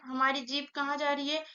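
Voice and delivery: normal talking voice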